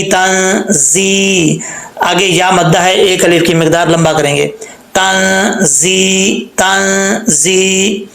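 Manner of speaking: तंजी slowly, with a hissing ज़ and long ई; tajweed recitation; whistled zaa. Shown as a demonstration. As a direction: stretch तंजी one alif. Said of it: "जा में सीटी आएगी".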